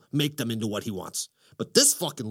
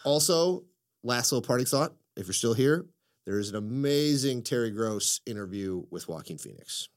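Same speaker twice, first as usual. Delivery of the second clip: ordinary talk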